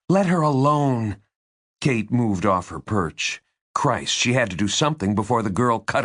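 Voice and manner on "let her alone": higher, forceful demand; protective order. A narrator's reading. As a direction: step in sharply to defend the girl. Kate defends the girl.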